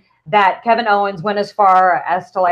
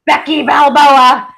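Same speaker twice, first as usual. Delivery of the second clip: slow, loud boxing-announcer call; hammed-up announcer; 5 semitones higher, 6 dB louder, slower than usual. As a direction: announce her like a boxer. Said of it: "repeating the nickname".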